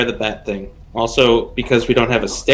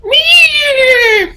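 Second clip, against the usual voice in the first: squeaky meow way up high; high bat squeaks; 7 dB louder than usual. Acted. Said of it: "I do my best, um, bat impression".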